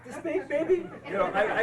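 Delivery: high, loud, laugh-broken self-mockery; helplessly amused, loud confession; laughing at himself. Real, not acted. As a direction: let laughter push the voice up high.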